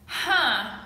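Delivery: ordinary talk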